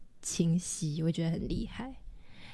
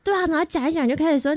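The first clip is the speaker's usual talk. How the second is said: normal talking voice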